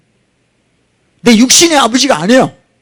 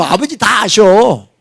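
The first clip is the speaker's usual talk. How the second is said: normal talking voice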